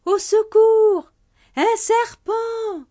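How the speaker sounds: panicked bride screaming; terrified scream. Acted. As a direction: shriek as if fleeing a snake. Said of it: "s'enfuit en hurlant".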